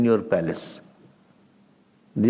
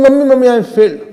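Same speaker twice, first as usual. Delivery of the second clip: put-on louder, higher child calling mummy; child telling mother about failing; child's voice; 7 semitones higher, 7 dB louder, faster than usual. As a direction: play the child announcing news.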